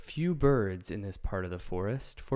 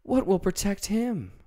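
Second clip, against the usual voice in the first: much higher, louder worried question; Dorothy fearing for Toto; anxious; 11 semitones higher, 5 dB louder than usual. A narrator's reading. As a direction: plead for the dog's safety. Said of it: "said the girl anxiously".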